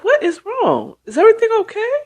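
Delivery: a high, worried mother's voice. Retold or imitated. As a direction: fret over her daughter.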